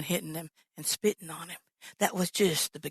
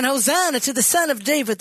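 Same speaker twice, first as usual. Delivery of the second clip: crowd acclaim; 6 semitones higher, 6 dB louder than usual. Retold or imitated. Crowd praising Jesus.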